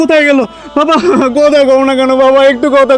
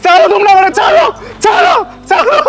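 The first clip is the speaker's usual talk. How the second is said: desperate shout; 6 semitones higher than usual. Acted. Son fighting relatives at grave, yelling. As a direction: struggle and scream let go.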